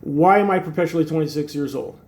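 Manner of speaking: usual speaking voice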